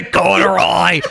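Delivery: drawn-out mock British night watchman; British accent. Voicing the night watchman finding Corduroy, mock British accent. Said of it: "I always assumed that he was British".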